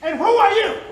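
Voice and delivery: defiant loud challenge. Acted. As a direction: challenge him to his face. Prisoner defies the interrogator.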